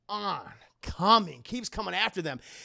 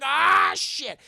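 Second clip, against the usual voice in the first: high, loud startled curse; acted alarm; 9 semitones higher, 8 dB louder than usual. Karen hears Chucky's alive, loud high alarm. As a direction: cry out, then curse.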